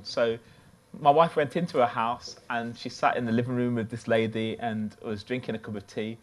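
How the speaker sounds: usual speaking voice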